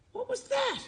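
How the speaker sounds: high, surprised cry; startled cry. Acted. Teddy Bear startled by cold water, high and surprised. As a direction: jump at the splash. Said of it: "he cried".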